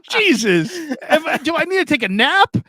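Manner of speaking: high-pitched indignant comic rant; pissed off. Retold or imitated. Son retells being taken for dad's brother, pissed off. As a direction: build into incredulous outrage, voice way up. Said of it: "I was so freaking pissed".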